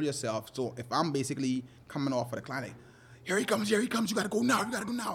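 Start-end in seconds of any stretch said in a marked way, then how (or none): 3.2-5.1 much higher, urgent hurry-up calls; excited drill sergeant. Retold or imitated. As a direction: hype the client like a drill sergeant. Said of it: "I turn into a drill sergeant".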